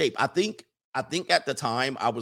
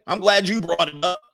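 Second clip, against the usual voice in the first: usual speaking voice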